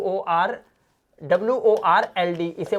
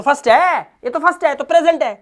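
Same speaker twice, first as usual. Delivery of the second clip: higher, louder emphasis; emphatic; 8 semitones higher, 5 dB louder than usual. Real, not acted. Teacher pressing the point on students, higher and louder. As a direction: stress the point hard.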